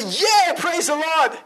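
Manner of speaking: high, drawn-out cheer; jubilant husband. Acted. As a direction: exclaim it slowly with rising joy. Husband rejoicing at pregnancy news.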